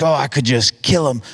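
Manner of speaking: half-hearted exasperated exaggeration; a touch of mock frustration; mildly angry mock venting. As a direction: imitate idle venting, not really meaning it. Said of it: "you're a little angry, you're a little sad".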